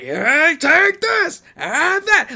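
hammed-up battle yell, very high and loud; imitated screaming. Fan imitates Go clearing trees in his robot, high hammy scream. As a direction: imitate an over-the-top anime hero shouting attacks. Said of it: "you can still hear him screaming".

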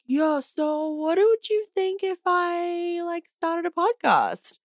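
high, drawn-out mock husband pitching an idea; imitating the husband. Husband floats a podcast idea in the kitchen, imitated in high voice. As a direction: put on his high, slow, tentative pitch. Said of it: "he was like".

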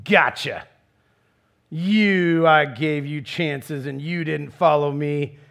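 mock smug jab, then a slow taunt; arrogant gloating. Preacher mocks an arrogant Jesus taunting the lost, smug gloat. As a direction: play a gloating, aloof Jesus, lightly hammed. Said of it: "stands aloof and arrogant going".

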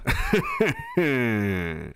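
goofy radio host laugh; imitated Howard Stern laugh. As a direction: do his trademark laugh. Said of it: "And he has that laugh".

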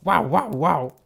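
hammed-up hound barking; greyhound bark. A narrator's reading. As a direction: bark like a big dog. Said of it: "and barked".

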